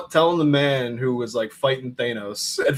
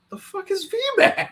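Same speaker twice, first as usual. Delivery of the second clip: much higher, laughing mock disbelief; baffled mock outburst. Host retells Metzger's reply to Peter, high baffled voice. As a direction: play up the bewilderment, pitched high.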